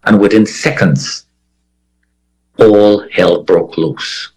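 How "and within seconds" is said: ordinary talk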